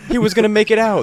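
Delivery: high, loud mock-tragic wail; mock movie drama. Mock sad movie line.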